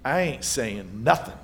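secretive disciple. Retold or imitated. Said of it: "it's one of those".

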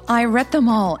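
usual speaking voice

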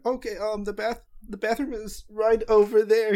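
high, flustered clerk; young boy voice. Acted. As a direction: point the way like a nervous kid. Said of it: "he said".

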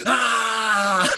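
long, high, loud yell; sustained high shout; imitated crowd roar. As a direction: roar like a whole arena jeering. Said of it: "ten thousand of them went".

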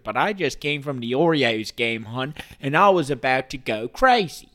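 put-on loud, worked-up Baltimore accent. Fan fresh from the Orioles game, loud Baltimore accent. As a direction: belt it out like a Baltimore local. Slips back into Baltimore local.

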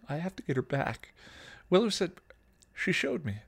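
lightly voiced desperate girl; tearful teen girl. Dawn begs Spike to let her get her mom back, teary. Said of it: "the teen sobbed".

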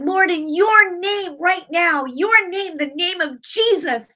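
high, loud, slow declaration; commanding prayer. Retold or imitated. Praying alone over her wrist, loud commanding prayer. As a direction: proclaim the name with authority.

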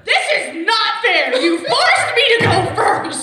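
slow, loud, laughing complaint; laughing protest. Real, not acted. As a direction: wail the injustice at your friend. Protesting being made to choose.

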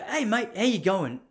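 breezy hello to a passing stranger; cheery greeting. Retold or imitated. Greeting the stranger on the path, slow and friendly.

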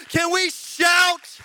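slow, loud, high call with shout stretched; drawn-out shout. Acted. Pastor calling the church to shout, slow loud call. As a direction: stretch out the word shout at full volume.

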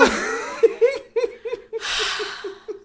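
laughing until it trails off; long helpless laugh. Real, not acted. Laughs while retelling awkward story.

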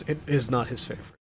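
low, hushed, quick narrator aside; deadpan documentary narrator. Pretend. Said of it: "Narrator voice".

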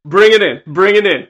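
loud huddle call; rally everyone in loudly; halftime pep speech. Retold or imitated. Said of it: "he's like".

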